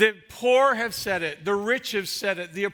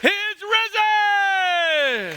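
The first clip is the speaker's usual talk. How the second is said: even higher, louder and very slow; soaring proclamation; 12 semitones higher, 8 dB louder, much slower than usual. Acted. Pastor's climactic Easter cry, slow and soaring. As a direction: stretch risen to the rafters. Calm down.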